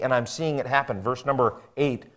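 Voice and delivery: ordinary delivery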